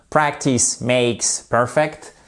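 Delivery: slow careful English. Shown as a demonstration. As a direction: savour the English proverb slowly. English proverb dropped in.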